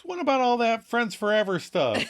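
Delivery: high, wounded mock complaint; hammed-up betrayed friend; hurt protest. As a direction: throw it back accusingly. Chandler's hurt protest, acted.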